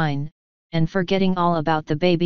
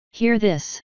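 a higher, lightly voiced exclamation of helpless despair; 4 semitones higher than usual. A narrator's reading. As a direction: throw it up to the heavens, without hope. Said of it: "he helplessly exclaimed".

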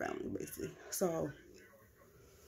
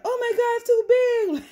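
whiny sister voice; 12 dB louder, much faster than usual. Retold or imitated. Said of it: "what she trying to say".

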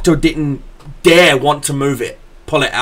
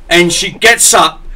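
usual speaking voice

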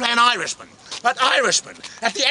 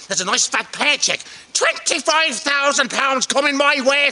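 ordinary delivery

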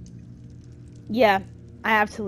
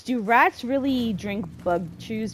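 normal talking voice

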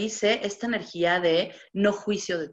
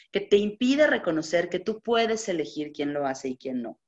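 usual speaking voice

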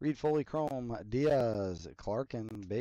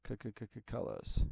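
playful stuttering whisper of the word; stuttered whisper; 6 dB quieter, slower than usual. Pretend. Vlogger teasing his own word to viewers, stuttered whisper. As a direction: break the word into a soft whispered stammer. Stuttering over the card colors.

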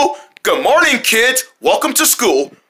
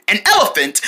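ordinary talk, nothing marked

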